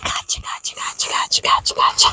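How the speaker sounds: quick, repeated whisper; playful whisper. Real, not acted. Owner teasing an animal with gotcha, playful whisper. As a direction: whisper it in a rhythmic patter.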